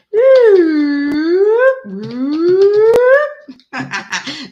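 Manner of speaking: loud, high burst of laughter. Real, not acted. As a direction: crack up out loud. Laughing at her own singing.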